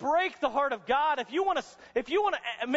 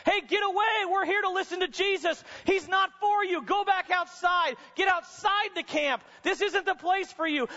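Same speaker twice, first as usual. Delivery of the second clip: higher, hostile mock yell at the leper; acted crowd yelling; 7 semitones higher than usual. Crowd chasing the leper out of the camp, mild mock yell. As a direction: shoo him off like an angry crowd. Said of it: "cursing and yelling at him".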